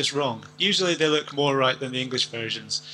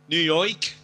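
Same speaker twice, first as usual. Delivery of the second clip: higher, put-on New York accent; 4 semitones higher, 4 dB louder than usual. Shown as a demonstration. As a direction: say New York like a caricature New Yorker. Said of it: "you've got New York".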